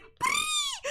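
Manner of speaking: extremely high squeak; baby Pokemon cry. Retold or imitated. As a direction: squeal like a tiny cute creature. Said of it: "And Togepi's like".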